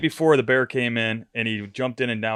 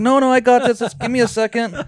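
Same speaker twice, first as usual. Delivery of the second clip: high, loud brush-off; retold flustered reply; 12 semitones higher, 6 dB louder than usual. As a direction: re-enact fending off a frantic helper, pitch way up. Retelling his flustered reply to Jim.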